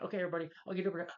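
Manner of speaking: high, rapid mock urgency; Bruce Campbell impression. Retelling the coach rallying everybody, quick high imitation. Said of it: "And Bruce Campbell's like".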